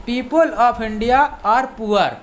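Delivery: very slow, careful teacher reading; slow model sentence. Shown as a demonstration. Teacher reads the example sentence slowly to students. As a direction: spell it out word by word. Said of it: "दूसरे हिसाब से बोल सकते हैं".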